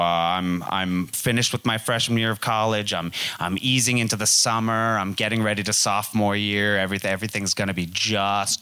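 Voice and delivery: ordinary delivery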